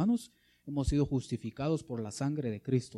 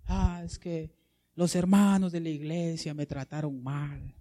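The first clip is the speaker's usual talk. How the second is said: mock whining. Preacher parodies a church member's excuses, mock whining. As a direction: whine like a wronged church member. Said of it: "¿Y qué hacía Bartimeo?".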